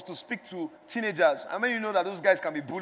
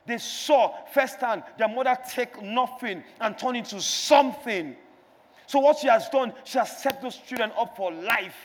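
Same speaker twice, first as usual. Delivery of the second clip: rising, louder sermon build; preaching; 2 semitones higher, 5 dB louder than usual. Acted. Pastor exhorting the church to build and pour, preaching. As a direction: preach it out to the congregation.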